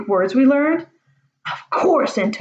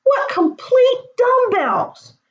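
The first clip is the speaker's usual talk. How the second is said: much higher, slow wailing groan; exasperated groan; much slower than usual. Acted. Mucius realising their mistake, despairing groan. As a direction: stretch it out in despair. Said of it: "Mucius groaned".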